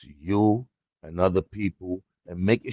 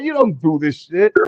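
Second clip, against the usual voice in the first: higher and louder mock scoff; imitating doubtful brother; 7 semitones higher, 4 dB louder than usual. Brother doubting he grew it himself, higher mock scoff. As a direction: scoff at him like a disbelieving brother. Brother teasing after laugh.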